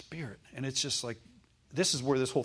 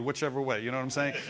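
ordinary talk

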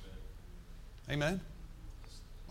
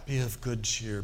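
put-on lower, solemn old-Bible reading; King James style; 4 semitones lower than usual. Shown as a demonstration. Preacher hamming up King James English for the congregation, solemn and lower. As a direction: make it sound religious, like King James English. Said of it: "you can make that real King Jamesy".

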